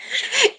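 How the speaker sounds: high, breathy giggle; gleeful high giggle; giggling. Real, not acted. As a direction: giggle at the trick you pulled. Laughs at catching Angie.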